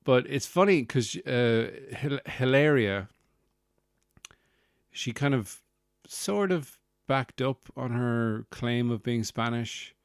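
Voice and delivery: usual speaking voice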